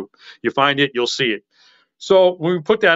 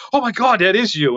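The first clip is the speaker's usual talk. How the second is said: amazed recognition; 6 semitones higher than usual. Retold or imitated. Fans' amazed reaction acted out.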